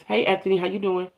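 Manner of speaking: put-on soft, sweet girly greeting; girl voice. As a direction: gently say hi in a girly voice. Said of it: "In my girl voice".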